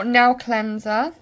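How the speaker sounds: Bristol accent, "nail" said like "now". Real, not acted. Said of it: "that's just my accent".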